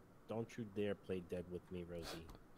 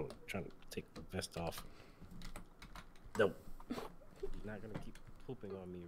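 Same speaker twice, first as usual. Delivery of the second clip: ordinary talk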